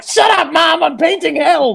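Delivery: mock furious yell, very high; annoyed son shouting. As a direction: holler at mom like an interrupted artist. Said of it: "the guy who's just like".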